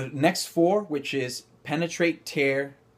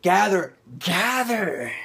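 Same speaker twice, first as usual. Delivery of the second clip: exaggerated gather; 6 semitones higher, 4 dB louder, slower than usual. Shown as a demonstration. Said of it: "when we exaggerate these things".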